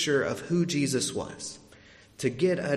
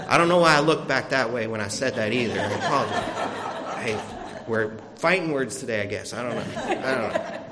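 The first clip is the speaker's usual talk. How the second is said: laughing; 4 semitones lower than usual. Real, not acted. Preacher laughing at his own aside to the congregation. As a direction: laugh it off, embarrassed at the slip.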